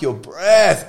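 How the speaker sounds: your breath yelled high and loud in disgust; imitating the wife recoiling; wife mocked shouting about his breath, high and loud. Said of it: "And she goes".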